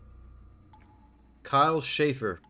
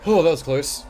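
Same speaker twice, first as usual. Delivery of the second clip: relieved exclaim; 5 semitones higher, 10 dB louder than usual. Real, not acted. Narrow escape from monster.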